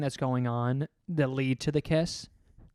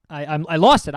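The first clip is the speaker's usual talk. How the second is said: ordinary talk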